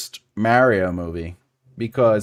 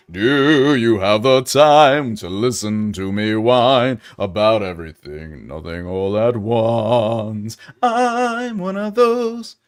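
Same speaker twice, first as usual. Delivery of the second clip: mock whiny voice; slower than usual. Reading a whiny chat line.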